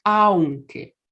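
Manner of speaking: "aunque" said slowly as two syllables, AUN-que, with the "au" glided together; careful aunque. Shown as a demonstration. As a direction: stretch out "aunque", gliding a into u. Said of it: "con esta pronunciación".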